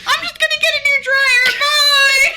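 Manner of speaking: very high, loud comic squeal; mock panicked brush-off. Homeowner shutting door on repairman, mock panicked squeal.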